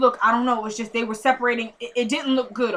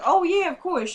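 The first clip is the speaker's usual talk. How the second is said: imitated eager agreement; 7 semitones higher than usual. Voicing friend eagerly agreeing to babysit.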